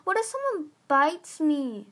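high worried whine; whining. Acted. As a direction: fret like a scared kid. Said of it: "Squid whined".

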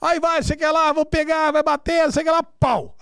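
high-pitched mock schoolyard goading; kids spoiling for a fight. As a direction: imitate a rowdy ring of kids. Imitating kids egging on a fight.

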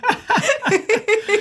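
high, bright burst of laughter; a real giggle at her own joke. Real, not acted.

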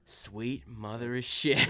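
slow, drawled outlaw exclamation; Arthur. Retold or imitated. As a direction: drawl it like a weary cowboy. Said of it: "then Arthur just goes".

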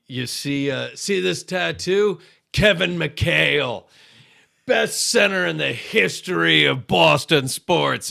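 a hint of a boozy Boston fan, slow and boastful; drunk Boston sports fan. [name] as a drunk fan brags to Peter in a slow, boastful voice. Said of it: "a sort of drunk Boston sports fan".

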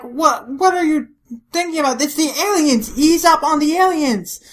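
high, loud acted correction; exasperated yelling. Kondo correcting Matsudaira about the aliens, exasperated yelling. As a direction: shout it in disbelief.

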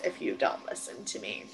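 whispered threat; menacing whisper. Retold or imitated. Olivia threatening Klaus, mock whisper. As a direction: hiss it as a cold threat. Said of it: "She's like".

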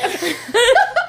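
high laugh. Real, not acted. Laughs at own habit.

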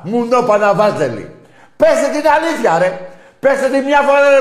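enraged taunting bellow; furious shout. Real, not acted. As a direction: scream at them to admit it. Demanding rival fans admit defeat.

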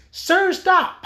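high-pitched little protest; mock pleading bystander. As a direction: act out someone begging him to stop. Voices someone during retold brawl.

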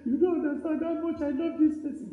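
high-pitched plea of a mourner; acted grieving protest. Mourner rebuffing someone who offers comfort, high acted protest.

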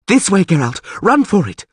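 high urgent call; urgent shouting. Acted. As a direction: shout it, desperate to get him out. Urgent escape, calling to him.

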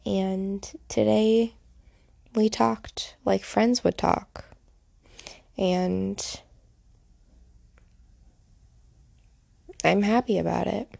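fighting back tears while speaking; choked with emotion. Real, not acted. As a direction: voice tight and choked, near tears. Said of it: "if my voice sounds like it's choked with emotion, it is".

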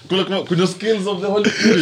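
laughing. Real, not acted. Laughing at skills joke.

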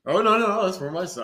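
loud start, fussy play-acted excuse; mock protesting parent. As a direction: protest too much as a pretend parent. Acts protesting fake parent at counter.

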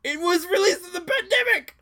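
high, loud, exasperated protest; exasperated outburst. Real, not acted. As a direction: cry it out in disbelief.

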